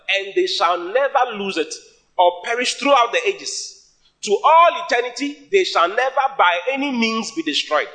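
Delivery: high, insistent scripture reading; emphatic proclamation. Real, not acted. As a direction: build in pitch and drive the promise home. Preacher stresses promise of security.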